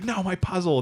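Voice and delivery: high-pitched imitation wail; hammed-up lament; mock dismay. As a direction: whine it high, in comic despair. Character laments his destroyed puzzle.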